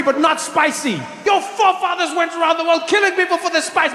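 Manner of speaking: ordinary delivery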